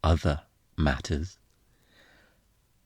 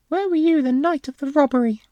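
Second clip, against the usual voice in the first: much higher, louder, mocking interrogator; mocking the detective's question in a squeaky put-on voice; 5 dB louder, faster than usual. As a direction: parody the detective's grilling tone. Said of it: "Save that voice for the bedroom".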